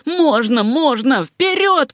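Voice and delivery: rising to a high cry; urging cries that climb in pitch; insistent shout. A narrator's reading. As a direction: argue back, then yell the order high. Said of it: "кричал Симпсон".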